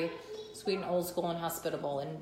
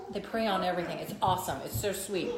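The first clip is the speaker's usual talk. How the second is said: usual speaking voice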